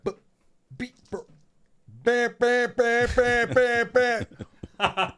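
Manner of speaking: mock him banging out bam bam bam; imitating the rapper's noises. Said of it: "This dude was like".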